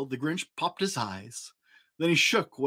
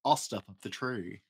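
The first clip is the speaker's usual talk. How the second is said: grinning Grinch; 6 semitones lower, 7 dB quieter than usual. A narrator's reading. Grinch plotting over the tree, low sly grin. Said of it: "grinned the Grinch".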